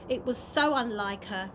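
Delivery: ordinary delivery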